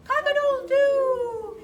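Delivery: loud, high hammed-up crow; rooster crow. Reading to child, the flustered fowl crows loudly. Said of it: "who crowed".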